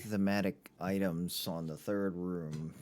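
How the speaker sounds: dry, slow sarcastic remark. Acted. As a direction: lay the sarcasm on thick, slowly. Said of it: "I say that very obviously sarcastically".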